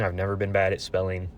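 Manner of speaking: ordinary delivery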